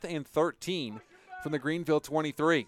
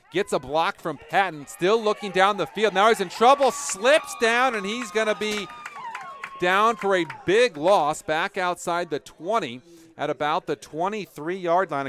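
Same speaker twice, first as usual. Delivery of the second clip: raised, louder live call as the play breaks down; excited play-by-play; 6 semitones higher, 7 dB louder than usual. Real, not acted. Announcer calls the quarterback's slip to listeners, excited play-by-play. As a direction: build urgency, then ease off after the fall.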